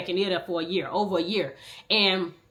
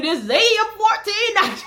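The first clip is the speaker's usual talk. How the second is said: higher, louder mock station ID; radio announcer voice; 13 semitones higher, 6 dB louder than usual. Imitating the radio station announcer, laughing. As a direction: belt the call sign like a DJ.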